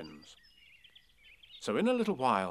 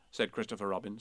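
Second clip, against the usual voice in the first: ordinary delivery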